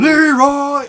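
imitated battle cry, high and loud; Leroy Jenkins. Reminding friends of an old meme, mock battle cry. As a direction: call it out like a war cry.

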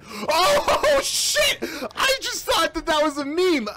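high, loud laughing, crying shout; laughing panic shout. Real, not acted. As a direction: shriek at an unexpected threat. Surprised by real danger.

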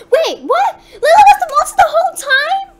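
very high, loud squeal of shock; incredulous, near-screaming outburst; shrieked disbelief. Real, not acted. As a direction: shriek it as the twist lands. Shock at monster's identity.

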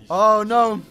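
normal talking voice

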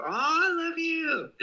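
high, slow gushing love. Real, not acted. Streamer gushing at a viewer's fan edits, high and slow. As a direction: melt over a sweet gift.

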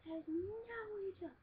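high, insistent accusation; mock high accusing sister. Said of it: "She's like".

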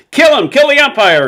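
high, loud furious yell; angry fan shout. Acted. Fan on the stand yelling at the umpire, loud. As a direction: scream it from the bleachers. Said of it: "shouted someone on the stand".

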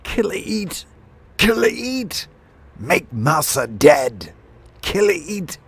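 very high screeching bird king; crazed sparrow war chant; mad exhortations. A narrator's reading. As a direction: shriek frenzied orders to the attackers. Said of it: "the mad exhortations of their king".

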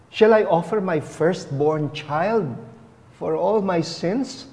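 ordinary talk, nothing marked

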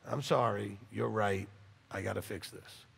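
soft, low, contrite admission; imitating someone accepting correction meekly; humble apology. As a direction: back down and own it quietly. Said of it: "you're gonna say".

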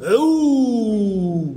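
عو held out long; stretched syllable. Shown as a demonstration. Teacher repeats the pupil's stretched عو for the class, drawn out. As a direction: drag out the syllable عو slowly. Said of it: "شدته بالواو".

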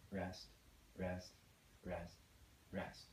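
low, soft, evenly spaced words; hushed rest count. Shown as a demonstration. Teacher marking silent beats after playing, hushed counting. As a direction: murmur each rest on the beat.

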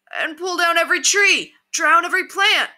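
high, loud cry of alarm; calling from crowd. A narrator's reading. Another bandit adds to the alarm, shouting. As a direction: shout over the crowd, pitch right up.

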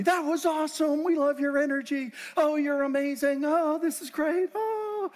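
high, bubbly praise; mock the fans' eager gushing; gushing fans. Audience members high-fiving the speaker, excited gushing.

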